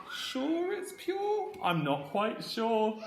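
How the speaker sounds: put-on very high, slow little boy voice; doubtful little boy. A lightly voiced boy doubts the witch's water, very high and slow. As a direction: squeak it out slowly like a doubtful small boy. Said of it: "this little boy's thinking".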